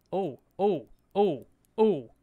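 loud, rising oh-ohs as the fish bites; excited yell. Real, not acted. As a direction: yell it out with a big fish on the line. Big fish starts to run.

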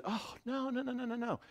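soft, high reassurance; imitating a wife's gentle, hurried denial; warm, loving. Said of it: "she responded in this warm, loving way".

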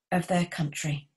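usual speaking voice

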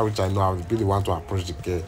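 ordinary talk, nothing marked

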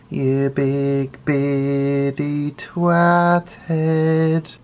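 high, slow, put-on sneering insult voice; mock insult voice. Insulting skit voice after segment intro.

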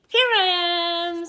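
proud loud reveal; triumphant reveal. Acted. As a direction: burst out from hiding. Dinosaur pops out of hiding.

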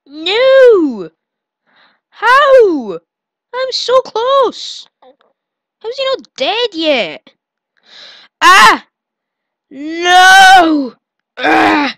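loud high panicked cries; frantic screaming. Real, not acted. Player screams as the chase goes wrong, loud high panic.

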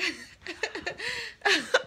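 high burst of laughter; laughing. Real, not acted. Laughing at the ladies.

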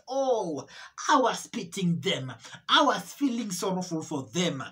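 ordinary delivery

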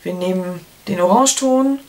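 ordinary delivery

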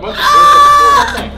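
high, loud, laughing scream. Real, not acted. Screaming at vomit.

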